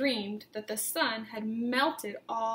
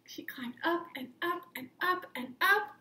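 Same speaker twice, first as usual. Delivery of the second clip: breathy rising whisper, higher with each up; suspense whisper; 5 semitones higher than usual. A narrator's reading. Teacher reading to children, whispering the climb up.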